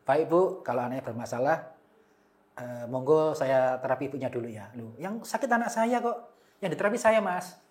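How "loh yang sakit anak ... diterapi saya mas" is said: imitating the mother. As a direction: play the puzzled mother, pitched up. Mother protests the therapist.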